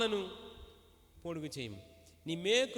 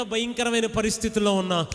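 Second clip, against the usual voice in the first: fast, rising preacher's cry; full-force sermon climax; fervent preaching; 2 semitones higher, 14 dB louder than usual. Real, not acted. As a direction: drive the promise home to the church.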